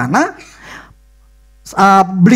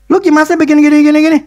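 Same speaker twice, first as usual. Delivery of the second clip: higher, louder scolding down the phone; 8 semitones higher, 5 dB louder, much faster than usual. Retold or imitated. Boss scolding his worker on the phone, higher and louder. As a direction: tell him off sharply on the phone.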